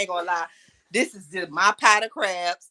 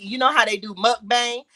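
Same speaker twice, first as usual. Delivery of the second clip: normal talking voice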